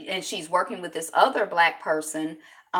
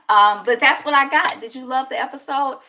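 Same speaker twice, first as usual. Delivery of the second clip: ordinary delivery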